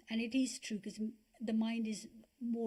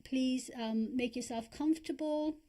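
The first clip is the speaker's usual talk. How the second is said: calm, unhurried guiding voice with long pauses; guided meditation. Acted. Teacher guiding listeners to relax their bodies, calm meditation voice. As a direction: ease them into breathing and relaxing.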